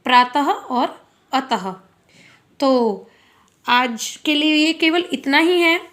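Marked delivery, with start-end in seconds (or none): 0.0-1.7 प्रातः and अतः each ending in "ha"; visarga sounded as ह. Shown as a demonstration. Teacher summing up the correct forms, final ह sounded in प्रातः and अतः. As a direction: slowly say praa-ta-ha, a-ta-ha for प्रातः और अतः. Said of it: "ये अत नहीं है".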